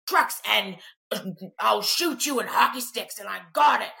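a hint of a swaggering boy; mock tough boy. Boy grabbing trucks in the toy aisle, mock tough.

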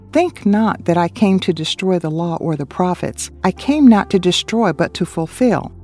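ordinary delivery

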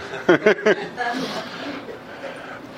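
high chuckle that dies away; amused laugh at your own aside; light, trailing laughter. Real, not acted. Laughs at own aside about age.

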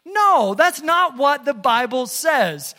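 loud, high-pitched objection; emphatic rebuttal. Real, not acted. Preacher rebutting false teaching to the congregation, emphatic.

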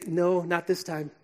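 soft, gently firm parent voice; calm the child as you leave, softly; a hint of a soothing parent; reassuring parent. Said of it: "they have to say".

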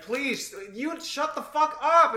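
mock annoyed moviegoer. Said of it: "Someone in the back's like".

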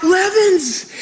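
high mock holler with laughter near; imitated coach's yell. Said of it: "as he's yelling at him".

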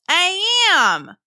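high, emphatic mosasaur agreement; loud-ish, high cartoon creature. A narrator's reading. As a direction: agree heartily as a chummy sea monster. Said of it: "Mo agreed".

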